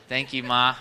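ordinary talk, nothing marked

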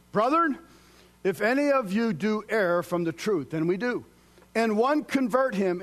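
loud, high, ringing scripture read; full-force preaching. Acted. Preacher proclaiming scripture loudly to congregation. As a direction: thunder the verse out.

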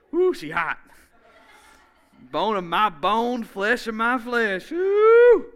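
loud, high, thrilled whoop; hammed-up delight at first sight; Adam gushing over Eve, loud and high; excited Adam. Adam sees woman, acted excitement.

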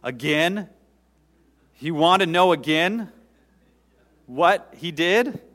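loud, high, incredulous questioning; exasperated. Retold or imitated. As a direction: act out a man at the end of his rope. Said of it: "coming to the end of his rope".

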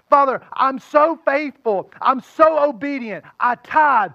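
mock the smug churchgoer bragging to God; self-righteous boasting. Angry believer boasting to the Father, loud and slow. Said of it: "you go to the Father and you say".

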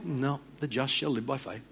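God closing the matter softly; soft, final divine answer; calm God's answer. Retold or imitated. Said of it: "he speaks very clearly".

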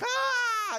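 high, long panicked "ah"; hammed-up scream of suspense; mock scream. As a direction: imitate viewers screaming at the TV. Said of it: "we're screaming at the T V".

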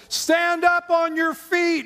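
high, slow booming command; ringing loud command, drawn out. Retold or imitated. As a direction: call it out loud to the lame man. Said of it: "heard Paul say".

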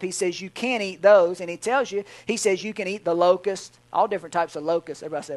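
usual speaking voice

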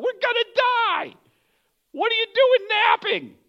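high-pitched, exasperated mock yell; mad captain. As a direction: play a panicked captain scolding a sleeper, voice way up. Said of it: "how mad would you be".

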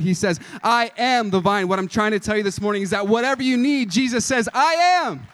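loud, building preacher's cadence rising to a peak; full-force revival preaching. Acted. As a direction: hammer each I am home to the congregation. Preaching climax, I am sayings.

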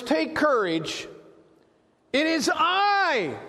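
high, slow, stretched-out call; voicing Jesus. Jesus to frightened disciples in the boat, calling reassurance. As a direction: announce yourself in a long, high call. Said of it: "Jesus spoke to them, saying".

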